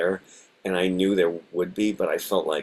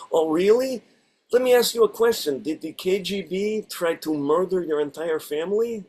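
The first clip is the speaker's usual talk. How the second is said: put-on Russian accent; 11 semitones higher than usual. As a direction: ham up a thick Russian accent. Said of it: "I get to do ... a Russian accent".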